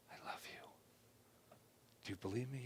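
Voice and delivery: breathy whisper of love; whispered reassurance. Shown as a demonstration. Speaker whispering reassurance to his own hurt feelings. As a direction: whisper it close and tender. Acted inner dialogue, whispered.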